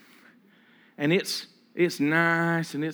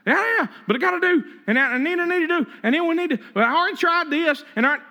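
much higher, fast frantic rambling; frantic babble; 10 semitones higher, faster than usual. Retold or imitated. Impatient friend babbling, much higher and fast. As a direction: rattle it off, panicking.

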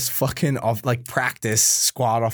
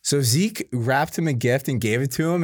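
normal talking voice

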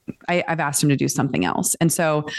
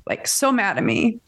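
usual speaking voice

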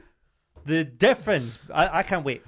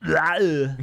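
gruff Arnold grunt, louder; 5 dB louder than usual. Retold or imitated. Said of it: "an impression of me is a noise".

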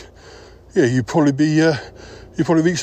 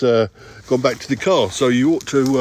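ordinary talk

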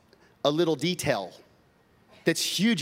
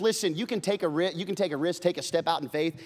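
ordinary delivery

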